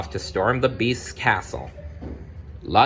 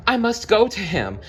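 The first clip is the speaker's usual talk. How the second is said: high, louder anguished cry; cried out; 13 semitones higher, 3 dB louder than usual. Acted. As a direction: plead in alarm for a dying father. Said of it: "Belle cried".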